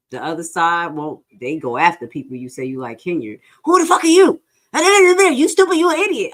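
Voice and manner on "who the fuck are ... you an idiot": loud, high-pitched mock yelling; angry bully. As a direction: imitate a bully hurling insults at full volume. Acts out bullies insulting people.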